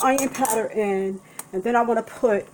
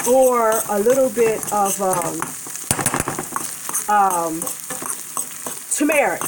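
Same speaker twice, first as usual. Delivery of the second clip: usual speaking voice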